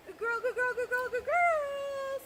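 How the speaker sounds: high, loud, excited dog praise; squeaky praising a dog. Real, not acted. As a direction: gush over the dog in a high voice.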